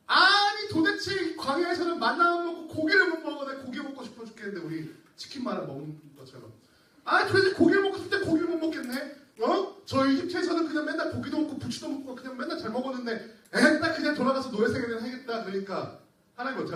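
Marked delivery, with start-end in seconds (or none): 0.1-4.6 high, loud whine for meat; complaining Israelites. Acted. Preacher voicing Israelites craving meat, whiny gripe. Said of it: "그 다음에는 또 뭐예요?". 7.0-15.4 high, loud whine for meat; complaining Israelites. Acted. Preacher voicing Israelites craving meat, whiny gripe. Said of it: "그 다음에는 또 뭐예요?".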